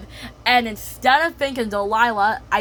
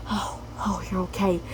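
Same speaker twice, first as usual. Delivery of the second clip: soft comfort; 5 dB quieter than usual. Acted. Delilah soothing the baby.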